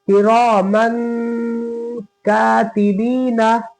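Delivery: किरामन कातिबीना slowly, nasal on the न of किरामन; nasal ghunna. Shown as a demonstration. Teacher recites for students, nasal and slow. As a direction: hum the ending of किरामन. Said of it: "नाक पे आवाज गई किरामन पे".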